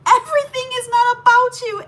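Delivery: high, loud, accusing mock yell; imitating angry sister-in-law. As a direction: throw it at her like a scolding sister-in-law. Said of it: "she starts going in on me".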